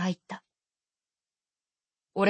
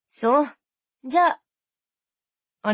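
breezy sister; 3 semitones higher than usual. Acted. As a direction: toss off a goodbye. Sister ending the call.

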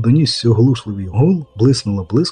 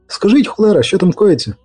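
high, exasperated question; pitched-up, impatient query; impatient demand; 7 semitones higher than usual. Acted. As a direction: demand an answer, cursing. Impatient repeated question, with curse.

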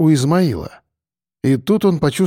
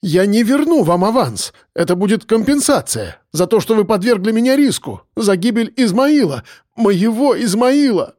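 firm, higher-pitched defiance; standing his ground, pitched higher; firmer voice; 7 semitones higher than usual. A narrator's reading. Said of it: "Голос Марка стал твёрже". (твёрдо, с вызовом)

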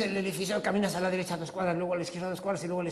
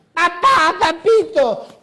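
put-on much higher, louder child's whine; little boy voice; 12 semitones higher, 15 dB louder, much slower than usual. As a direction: whine like a scared toddler.

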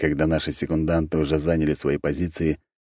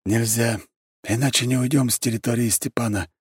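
plain reading voice

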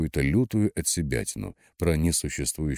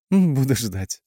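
high, louder, playful sign-off; giggly; 8 semitones higher, 5 dB louder, slower than usual. A narrator's reading. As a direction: say it with a hint of giggle. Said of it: "хихикнула тринадцать семнадцать". (игриво, будто хихикая)

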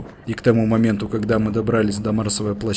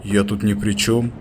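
usual speaking voice

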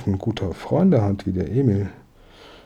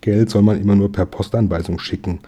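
ordinary delivery, nothing marked

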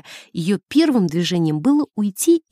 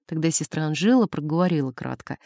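ordinary delivery, nothing marked

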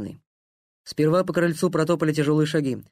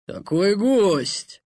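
put-on slow slurred drunken welcome; tipsy soldier greeting loudly; drunk rambling toast; 6 semitones higher, 4 dB louder, much slower than usual. Drunk Gleb welcoming guest.

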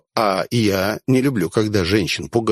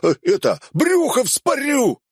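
frantic shouting thug; desperate shout; 9 semitones higher than usual. Acted. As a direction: scream the threat in panic. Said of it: "заорал он".

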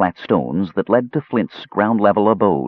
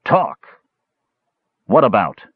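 high first word, then a curt question; unfriendly; 3 semitones higher, 3 dB louder than usual. A narrator's reading. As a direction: ask it with no welcome at all. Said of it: "Flint asked in an unfriendly tone".

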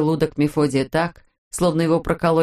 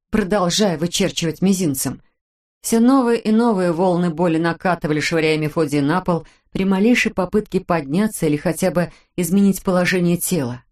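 ordinary delivery, nothing marked

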